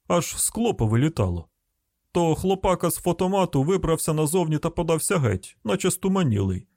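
grumbling hillbilly car owner; rustic householder. Acted.

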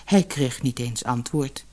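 ordinary delivery

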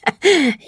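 high wheezing monster laugh; a rasping, diseased chuckle; croaking creature laugh; rattling laugh. A narrator's reading. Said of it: "Wieder ein röchelndes Lachen".

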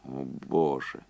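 soft, subdued exclamation; barely audible. A narrator's reading. Andy reacting to Quincy's grim news, barely audible. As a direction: say it low, under your breath. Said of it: "едва слышно произнёс Энди".

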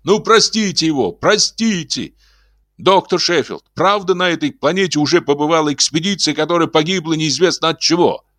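high, excited pleading cry; excited shout. A narrator's reading. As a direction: shout it up high, all worked up. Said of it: "возбуждённо закричал".

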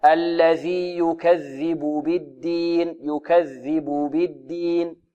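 الذي يكذب بالدين drawn out slowly, each vowel held full; teacher's correct take after the wrong one; very slow correct reading. Shown as a demonstration. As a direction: say it very slowly, nothing clipped. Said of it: "لا".

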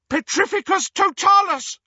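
high, loud screamed spell; screaming. Acted. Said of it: "screamed Hermione".

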